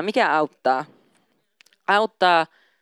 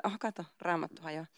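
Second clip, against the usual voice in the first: usual speaking voice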